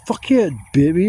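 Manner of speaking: loud indignant answer; angry retort. Retold or imitated. Answering the dog owner, angry and loud. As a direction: snap back angrily. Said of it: "And I'm like".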